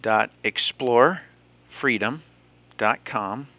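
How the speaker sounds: slow, word by word; dictating. Real, not acted. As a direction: spell out the address slowly. Dictating the web address.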